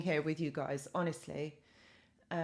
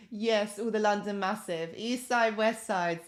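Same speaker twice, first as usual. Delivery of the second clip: ordinary talk, nothing marked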